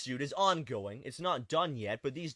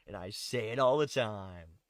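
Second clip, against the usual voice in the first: usual speaking voice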